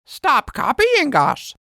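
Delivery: high, slow squawky parrot echo; parrot copying. Acted. Parrot teasing the girls by copying, high parrot voice. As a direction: parrot mimics the words back. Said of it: "Parrot repeats".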